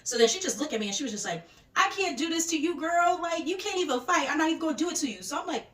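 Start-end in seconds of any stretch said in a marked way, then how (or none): 1.8-5.2 confrontational dismissal. Retold or imitated. Jealous ex dismissing the new girl, high mocking attitude. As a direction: imitate an ex dismissing a rival.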